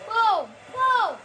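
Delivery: two high, loud booms; triumphant call-out. Real, not acted. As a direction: punch out each boom in triumph. Celebrating a play.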